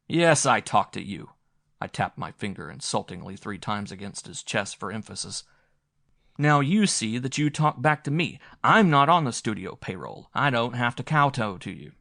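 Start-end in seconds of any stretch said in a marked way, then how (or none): 0.1-1.3 loud mocking echo. A narrator's reading. Mocking his words back, loud. 6.4-11.9 loud, high indignant rebuke; angry confrontation. A narrator's reading. Narrator loudly rebuking the meek director, angry.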